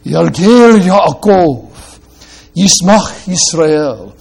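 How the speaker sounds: slow "Yalgel Yaakov, Yismach Yisrael" with rhyming first syllables; Hebrew recitation. Shown as a demonstration. Preacher reciting the Hebrew line to show its rhyme, slow and savoured. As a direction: savour each Hebrew word slowly. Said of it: "a poeticism in them, which I love".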